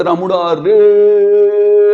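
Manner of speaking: "रामुड़ा रे" with a very long held "रे"; long distance call. Shown as a demonstration. Calling till the friend hears, "रे" endlessly drawn out. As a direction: keep "रे" going on one slow, sustained note. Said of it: "ऐसे करके".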